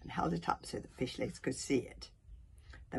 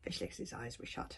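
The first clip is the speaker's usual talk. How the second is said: plain reading voice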